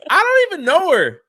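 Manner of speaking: high, loud incredulous blurt; excited disbelief. Retold or imitated. Telling Fox News hosts he doesn't know Gina, high loud disbelief. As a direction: burst out that you barely know her.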